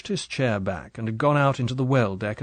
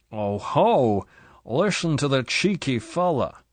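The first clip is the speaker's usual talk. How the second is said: mocking drunk; 3 semitones higher, slower than usual. Drunk husband mocks Bond.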